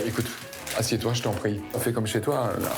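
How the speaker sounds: ordinary talk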